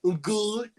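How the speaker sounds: high put-on comedy character voice; Mister Goodman. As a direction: pitch it way up as a comic impression. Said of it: "basically in the impressions of".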